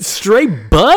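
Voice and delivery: loud, high crowing; hyped exclamation. Real, not acted. Confirming the nudity to co-host, hyped.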